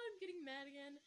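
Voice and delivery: a hint of cartoon character, trailing softly. Narrator replaying her own outburst, cartoon voice. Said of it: "I should be a cartoon voice actor".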